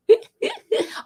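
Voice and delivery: a short, bright laugh; high burst of laughter. Real, not acted. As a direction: laugh it off before the wrap-up. Laughing before closing.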